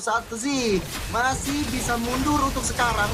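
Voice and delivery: high, excited play-by-play call; esports caster. Acted. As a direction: ride the teamfight, pitch climbing.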